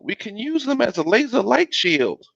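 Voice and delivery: put-on high-pitched child voice; excited little boy. A narrator's reading. Little Bill excited with glow sticks.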